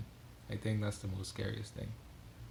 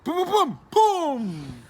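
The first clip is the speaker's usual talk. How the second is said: very high, loud shouted booms; mock explosion to launch the clip; booming kickoff noise; 14 dB louder, much slower than usual. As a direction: belt out the booms, last one drawn out. Imitates a bang to start clip.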